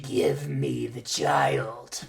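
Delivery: put-on a sinister man claiming the child; creepy director.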